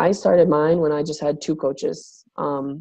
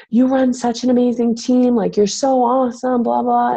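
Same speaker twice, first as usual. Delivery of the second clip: gushing friend; 8 semitones higher, 3 dB louder than usual. Retold or imitated. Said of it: "Jenny, is always like".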